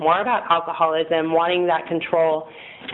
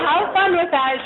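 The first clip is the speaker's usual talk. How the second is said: sarcastic mom; 11 semitones higher than usual. Retold or imitated. Mom's sarcastic reply voiced.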